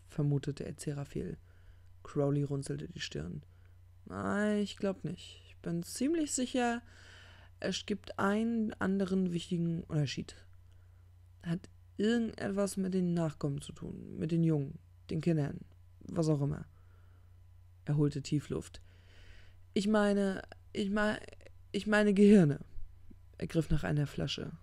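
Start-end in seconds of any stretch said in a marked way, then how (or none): 4.0-16.7 higher-pitched, groping for words; unsure rambler hunting for the word; rambling drinker. Acted. Crowley voiced, groping for words. 19.7-22.6 higher, stumbling towards the word; groping, then a loud find; halting false starts. Acted. False starts before finding the word.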